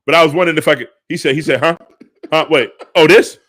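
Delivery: loud, high comic courtroom protest; flustered mock plea. Host clowning to Your Honor about what that man said, flustered mock plea. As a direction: blurt it loudly over the interruptions.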